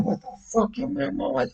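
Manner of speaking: squeaky high-pitched mock disbelief; shrill retold "what am I"; retold outburst. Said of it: "I said".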